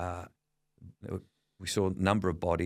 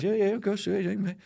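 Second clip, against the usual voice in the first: high-pitched mimicked Dad; imitating agreeable Dad; 12 semitones higher than usual. Dad agreeing to New Orleans, imitated in high voice. Voicing Dad's eager agreement.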